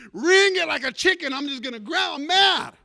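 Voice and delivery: acted fury, loud and high; angry outburst. Pastor fuming at the man watching him, loud mock rage. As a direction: erupt as if at the end of your rope. Acting out his angry thoughts.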